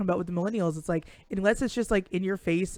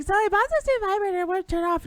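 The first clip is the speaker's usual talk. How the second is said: much higher, flustered mock voice; squeaky mock panic; 13 semitones higher than usual. Acted panicky phone excuse.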